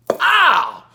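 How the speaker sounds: high, exaggerated "ow"; loud mock howl. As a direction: let out a big theatrical cry. Acting out Mallory's power blast.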